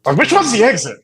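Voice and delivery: high, loud, bewildered; confused lost driver. Pretend. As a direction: play a lost driver who can't find the exit.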